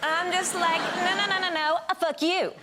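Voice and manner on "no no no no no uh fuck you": high, rapid-fire refusal into a retort; playfully defiant, pitched up; mock-indignant comeback. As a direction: wave it off and hit back. Acted escalating exchange of insults.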